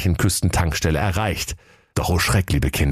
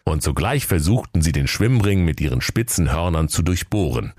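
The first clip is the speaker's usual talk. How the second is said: plain reading voice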